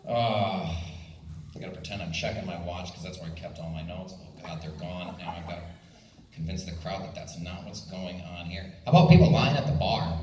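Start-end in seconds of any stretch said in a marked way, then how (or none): 0.7-8.7 low, quiet aside to himself; mock private aside. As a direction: drop it low, as if covering a slip. Muttered aside about lost notes.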